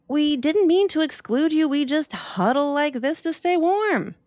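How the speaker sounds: high, apologetic penguins; huddled penguins apologizing together; penguin voices. Acted. Penguins apologizing to Opal, high and sorry. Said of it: "The penguins all said".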